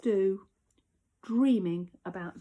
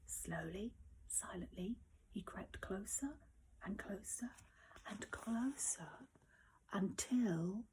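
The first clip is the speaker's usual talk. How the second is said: hushed storyteller whisper; 2 semitones lower, 17 dB quieter than usual. A narrator's reading. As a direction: creep the words out in a whisper. Narrator builds sneaking suspense.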